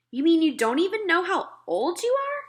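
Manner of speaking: put-on a small girl asking politely; little girl Sophie. Sophie's voice, higher.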